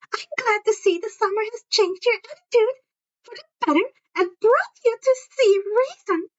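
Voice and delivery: syrupy, condescending teacher voice; sugary Umbridge. Acted. Umbridge scolding Harry before the class, saccharine.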